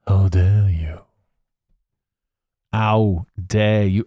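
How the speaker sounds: low, slow, drawn-out reproach; hammed-up grave scolding; mock indignation. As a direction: stretch out each word in theatrical disgust. Repeats the outrage low and slow.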